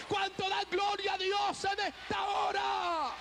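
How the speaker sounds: rising, shouted preacher's cries of praise; full-force preaching. Acted. Preacher rousing the congregation, shouting praise. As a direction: preach it out to the whole church.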